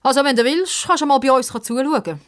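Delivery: high, taunting offer; condescending. Acted. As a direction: patronize the loser. Boy condescendingly invites Pippa to watch.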